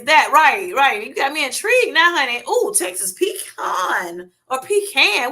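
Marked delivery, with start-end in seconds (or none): 3.1-4.3 "pecan" said as pee-KAHN; pronounced pee-KAHN. Shown as a demonstration. Asking viewers which way they say it, pee-KAHN. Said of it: "Which one y'all say?". 4.6-5.3 "pecan" said as PEE-can; pronounced PEE-can. Shown as a demonstration. Asking viewers which way they say it, PEE-can. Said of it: "Which one y'all say?".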